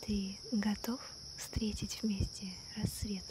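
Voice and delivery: ordinary delivery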